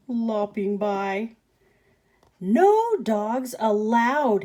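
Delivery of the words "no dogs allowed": loud, very slow yell. Acted. Cactus yelling at the coyote, loud and very slow. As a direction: lay down the law. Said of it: "Hank yelled".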